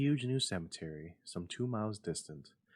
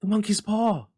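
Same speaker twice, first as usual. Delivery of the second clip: high, loud, wild outcry; a woman waking with a sudden idea; frantic high shout; wild cry; 13 semitones higher, 14 dB louder than usual. A narrator's reading. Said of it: "she cried wildly".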